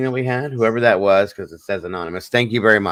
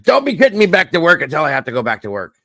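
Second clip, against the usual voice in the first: mock scolding, higher and louder, fast; imitating mom's scolding; 8 semitones higher, 7 dB louder, faster than usual. Retelling mom's chat scolding, hammed-up higher faster voice. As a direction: play mom snapping back at him. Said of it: "she's yelling at me now".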